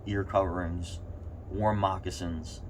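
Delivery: ordinary delivery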